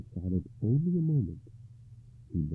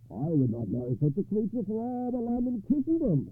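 high-pitched, put-on guest's voice; appalled companion, voice pitched way up; scornful knight; 3 dB louder than usual. A narrator's reading. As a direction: refuse it in a raised, emphatic pitch. Wedding guest scorns the bride.

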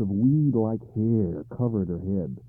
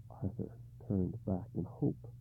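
ordinary delivery, nothing marked

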